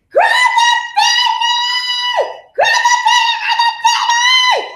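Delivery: very high, loud urgent shout; urgent yelling. Retold or imitated. Mother shouting at her partner to catch the baby, very high. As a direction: yell for him to catch it.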